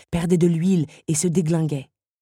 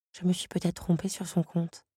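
much softer, musing aloud; half to herself; soft doubt; 8 dB quieter than usual. Acted. Belle reconsiders the beast, softly.

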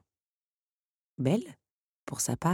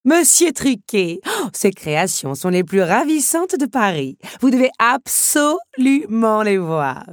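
high, loud, drawn-out enthusiasm; theatrical, thrilled insistence; rapturous; 7 semitones higher, 8 dB louder than usual. A narrator's reading. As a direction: gush with delight, stretching the key words. Countess raves about automaton maker.

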